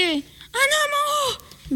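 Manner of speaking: très aigu et très lent; un appel terrifié, étiré; cri de frayeur. Acted. Boy sees a man above. (voix d'enfant terrifié, aiguë et traînante)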